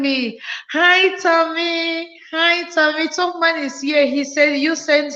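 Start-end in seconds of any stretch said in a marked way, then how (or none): none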